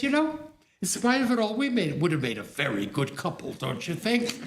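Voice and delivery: put-on old friend teasing her companion, high and playful; teasing old lady. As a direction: tease him with a high, bright lilt, ending in a laugh. Verena's sudden teasing proposal.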